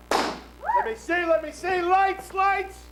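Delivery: high, loud, drawn-out showman call; grandiose flea circus showman. Acted. Calling for lights, showman.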